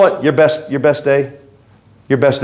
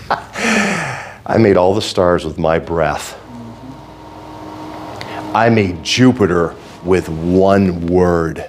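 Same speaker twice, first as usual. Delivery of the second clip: slow voice of God; 4 semitones lower, 4 dB quieter, slower than usual. Retold or imitated. God speaks slowly.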